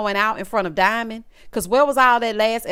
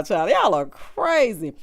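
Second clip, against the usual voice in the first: ordinary delivery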